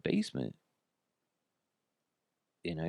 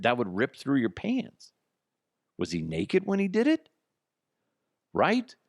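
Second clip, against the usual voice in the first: incredulous; 7 semitones higher, 3 dB louder than usual. Real, not acted. Disbelief at the patient's story.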